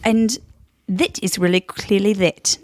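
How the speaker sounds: slow Queen's English, veering Kiwi; badly faked royal accent with New Zealand vowels; amateur Queen's English, drifting into New Zealand; Queen's English attempt. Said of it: "the Queen's English".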